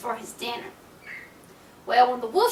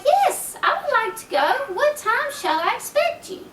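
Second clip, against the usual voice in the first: much higher, eager little pig; chirpy little pig, bright and keen; 9 semitones higher than usual. Acted. Little pig accepts wolf's invitation.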